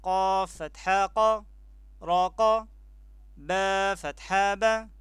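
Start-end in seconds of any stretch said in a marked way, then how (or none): none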